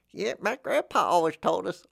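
high put-on voice of a smug relative; mock family-lore teller. Mocking people who trust family lore, high put-on voice. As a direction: parody someone boasting about grandpa.

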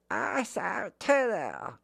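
put-on high, slow, tiny toddler. Shown as a demonstration. Voice teacher overshooting the kid character, toddler voice. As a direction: squeak it out like a toddler. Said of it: "like a toddler".

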